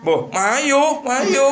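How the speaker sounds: high-pitched, mock-eager "mayu, mayu"; eagerly accepting the offer, hammed up; mock eager man. Voicing an eager man in the story.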